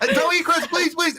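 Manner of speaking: high, pleading whimper of a panicked client; hammed-up high-pitched begging; mock begging. Client begging the cam girl on chat, high pleading whine. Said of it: "And he'd be like".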